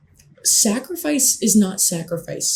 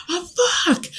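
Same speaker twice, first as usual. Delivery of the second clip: emphatic shout; 13 semitones higher than usual. Real, not acted. Stressing how little he cares, high emphatic yelp. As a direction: pitch jumps way up on the repeat. Repeating his own phrase for emphasis.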